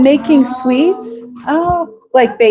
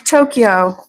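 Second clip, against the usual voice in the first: normal talking voice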